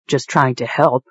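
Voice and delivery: ordinary delivery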